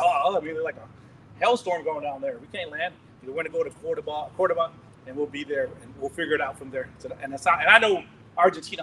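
ordinary delivery

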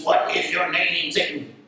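slow, drawn-out mock exorcist; hammed-up demand, slow and sinking; movie exorcist. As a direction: parody a film exorcist, dropping low at the end. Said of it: "you see all these movies".

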